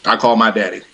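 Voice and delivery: ordinary talk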